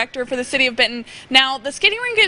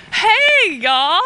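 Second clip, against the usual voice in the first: bright call-out greeting; 6 semitones higher, 3 dB louder than usual. Real, not acted. Skating over to greet a family.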